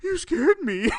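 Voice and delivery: high-pitched spooked voice; mock startled. Intern spooked by the prank, imitated as mock startled. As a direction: imitate someone wide-eyed with fright. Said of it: "his eyes are super big, like big saucers".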